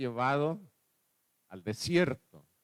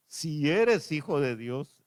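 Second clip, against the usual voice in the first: slow devil's taunt; 3 semitones higher, 4 dB louder, slower than usual. Retold or imitated. Devil's taunt read slowly.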